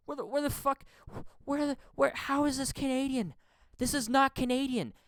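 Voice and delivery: high-pitched incredulous rant; outraged disbelief. Real, not acted. Ranting at the media's sob story, high-pitched outraged disbelief. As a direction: protest it way up high, stumbling over the words.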